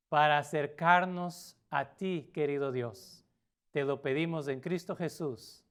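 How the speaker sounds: ordinary delivery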